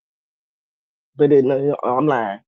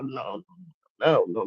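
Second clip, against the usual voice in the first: ordinary delivery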